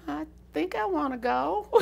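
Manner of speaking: lightly voiced younger self; young girl answer. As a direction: answer shyly like a fifteen-year-old. Retells her teenage answer.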